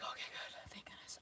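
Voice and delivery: a voiceless, relieved little whisper. Retold or imitated. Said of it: "I was like".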